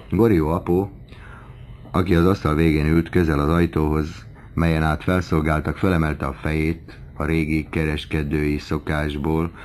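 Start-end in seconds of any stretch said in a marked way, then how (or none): none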